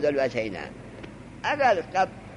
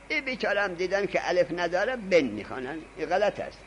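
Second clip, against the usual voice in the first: usual speaking voice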